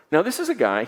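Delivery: ordinary talk, nothing marked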